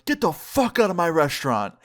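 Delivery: acted angry order. Restaurant manager pushes Jared and orders him out, angry retelling. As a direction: imitate an angry owner throwing someone out.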